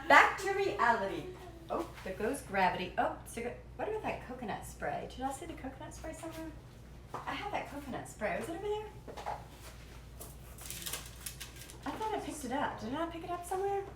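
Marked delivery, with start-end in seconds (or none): 3.7-13.8 soft, high puzzling aloud; half-mutter your search up high; high puzzled murmur. Acted. Cook looking for coconut spray, murmuring high and puzzled.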